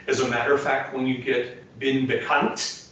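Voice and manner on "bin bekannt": "bin bekannt" slow, the T released sharply; "bin bekannt" with a noisy, crisp ending consonant; percussive final T. Shown as a demonstration. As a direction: say "bin bekannt" and pop the last T. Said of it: "get that T out there".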